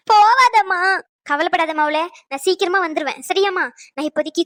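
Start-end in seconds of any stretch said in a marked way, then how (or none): 0.1-0.8 high, loud call after her mother; desperate cry out. Acted. Daughter calls after leaving mother. (போகாதே என்று உரக்க கதறல்)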